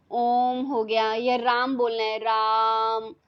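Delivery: ओम and राम stretched long and slow; slow drawn-out om and ram. Shown as a demonstration. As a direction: let each word ring out slowly. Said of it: "बहुत समय लग रहा है ना उसको बोलने में". (ओम और राम को धीरे, लंबा खींचकर)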